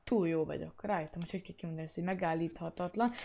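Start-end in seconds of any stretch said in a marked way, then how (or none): none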